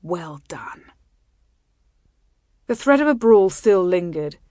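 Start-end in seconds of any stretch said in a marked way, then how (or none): 0.0-0.9 very soft, hushed praise; whisper. A narrator's reading. Groom repeating praise to his bride, whispering. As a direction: murmur it close to the ear. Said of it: "he whispered in her ear".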